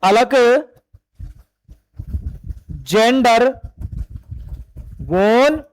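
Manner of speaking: Alexander stretched very slowly, syllable by syllable; spelled out slowly. Shown as a demonstration. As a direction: draw out Alexander so students can write it down. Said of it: "एवी क्या है?". (Alexander को बहुत धीरे, एक-एक अक्षर खींचकर बोलें)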